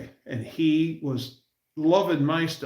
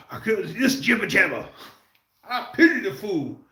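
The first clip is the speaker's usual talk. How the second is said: raised, punchy tough-guy catchphrases; Mister T impression; 7 semitones higher, 4 dB louder than usual. Fan quoting Mister T's catchphrases with tough swagger. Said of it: "was Mister T".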